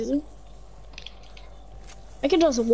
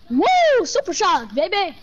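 excited cheer; 4 semitones higher, slower than usual. Real, not acted. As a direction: cheer it like a win. Celebrating a move.

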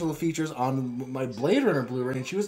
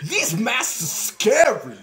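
high, loud, slow comic exclamation; Tracy Morgan; 8 semitones higher, 6 dB louder, much slower than usual. Acted. Imagining the comedian starring in this film, hammed-up impression. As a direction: stretch it out as a hammed-up celebrity impression. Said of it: "if this film starred Tracy Morgan".

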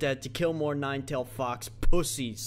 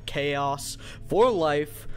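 ordinary talk, nothing marked

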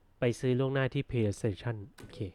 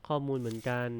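normal talking voice

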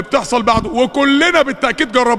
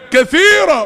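كثيرة shot up high and louder, falling hard; excited climax; 7 semitones higher, 4 dB louder, slower than usual. Real, not acted. Preacher hammering home his point to the congregation, high and loud. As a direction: peak of the build, ring out كثيرة.

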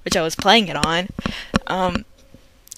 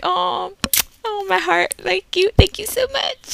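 gushing thanks; 12 semitones higher than usual. Retold or imitated. Thanked after being called funny.

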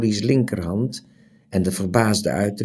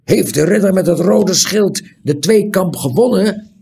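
much higher, loud aghast outcry; dismayed cry; 10 semitones higher, 6 dB louder than usual. A narrator's reading. Dismayed loud outcry. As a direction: innkeeper cries out in dismay at the news. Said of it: "riep de waard ontsteld".

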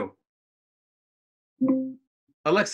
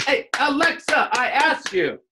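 mock indignant call; 10 semitones higher, 4 dB louder, slower than usual. Mocking friend scolding Alexa.

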